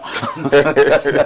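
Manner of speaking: short run of chuckles; real amused giggle; amused laugh. Real, not acted. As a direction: chuckle openly at the message. Laughing after reading a listener's message.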